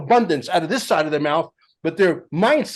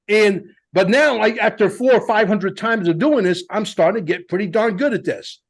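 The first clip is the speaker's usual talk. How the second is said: ordinary delivery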